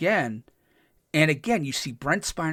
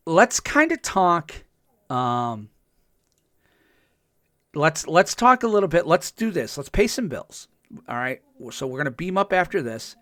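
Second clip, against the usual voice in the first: upbeat sign-off, bright and louder; host break announcement; 3 semitones higher, 4 dB louder than usual. Acted. Host hyping the audience before the break, upbeat call. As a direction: lift the energy into the ad break.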